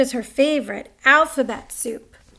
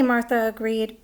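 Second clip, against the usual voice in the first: plain reading voice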